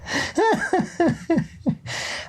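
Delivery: squeaky high laugh. Real, not acted. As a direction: burst into a high giggle. Laughing at the legend.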